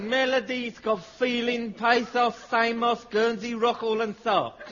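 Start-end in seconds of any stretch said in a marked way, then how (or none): none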